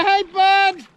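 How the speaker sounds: calling out. Real, not acted. Calling to whale.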